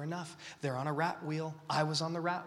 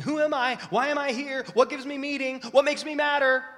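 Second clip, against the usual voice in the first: higher, much louder desperate cries; anxious shouting questions; 11 semitones higher, 10 dB louder than usual. Retold or imitated. Preacher voicing a lost generation, shouting anxious questions. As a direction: shout the questions like a panicked crowd. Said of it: "everybody else is asking".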